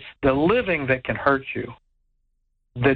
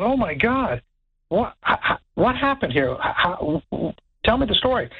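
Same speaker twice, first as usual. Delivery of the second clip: stammering, high-pitched disbelief; flustered, replayed astonishment; retold shock; 8 semitones higher than usual. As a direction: stumble over the words in alarm. Retells own shocked reaction to picture.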